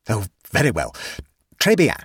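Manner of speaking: grudging, hesitant agreement; reluctant assent. A narrator's reading. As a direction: give in reluctantly, then into French. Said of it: "said Missus Stossen reluctantly".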